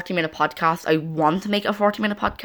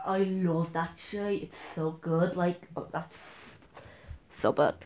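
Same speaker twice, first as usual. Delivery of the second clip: bad Derry accent; 14 dB quieter than usual. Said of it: "Such a bad Derry accent".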